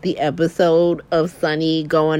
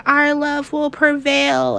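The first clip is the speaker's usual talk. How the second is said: mock Nina; 7 semitones higher, slower than usual. Mimicking Nina's dreamy reply.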